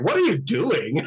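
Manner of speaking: a touch of a bemused cop; playful skit voices. Pretend. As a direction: act out the traffic stop in a lifted voice.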